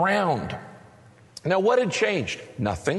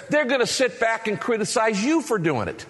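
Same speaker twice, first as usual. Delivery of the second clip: ordinary talk, nothing marked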